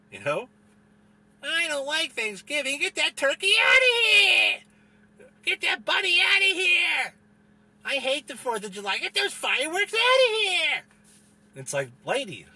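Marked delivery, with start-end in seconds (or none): 1.4-10.8 much higher, louder, slow sobbing yell of a hysterical complainer; mock wailing shout. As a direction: ham it up as a crying tantrum, bawling out each demand. Hammed-up wailing holiday hater.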